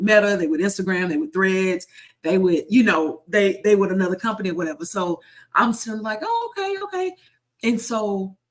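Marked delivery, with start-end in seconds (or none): 6.2-7.2 high, sing-song "oh, okay"; mock realization. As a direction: act like it suddenly clicks. Realizing she works for Meta.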